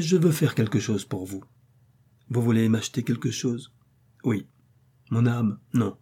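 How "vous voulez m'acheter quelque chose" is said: ordinary delivery, nothing marked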